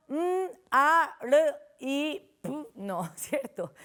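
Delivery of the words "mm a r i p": "mm, a, r, i, p" as isolated letter sounds. Shown as a demonstration. Teacher showing what not to do, "mm, a, r, i, p" letter by letter. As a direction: sound out each of "mm, a, r, i, p" separately. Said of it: "Yo no digo".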